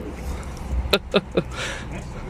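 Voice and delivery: breathy scornful snicker; mocking laugh. Real, not acted. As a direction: laugh him off under your breath. Guard mocks auditor after insult.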